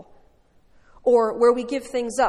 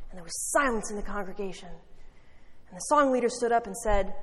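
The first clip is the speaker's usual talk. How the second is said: ordinary delivery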